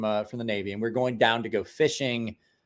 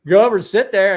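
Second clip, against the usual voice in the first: calling out; 7 semitones higher, 11 dB louder than usual. Retold or imitated. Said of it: "he's like".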